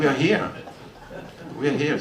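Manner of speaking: a hint of the excited owner calling back; eager owner reply.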